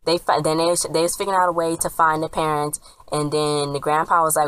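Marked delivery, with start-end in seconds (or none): none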